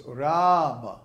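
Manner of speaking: "Rama" with a long, loud "Raa" and short "ma"; long first syllable. Shown as a demonstration. Teacher demonstrating the rhythm, "Rama" long then short. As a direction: hold the "Ra" of "Rama" two beats. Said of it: "first A in Ram is long".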